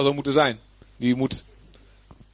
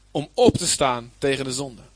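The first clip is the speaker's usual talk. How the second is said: normal talking voice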